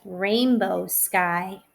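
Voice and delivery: sing-song rhyming read-aloud; reading to children. Acted. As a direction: bounce the rhyme for little kids. Reading a rhyming picture book.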